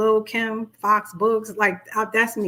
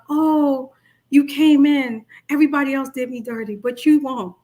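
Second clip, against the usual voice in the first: narcissist flattery; 4 semitones higher, 5 dB louder than usual. Retold or imitated. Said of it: "it's like".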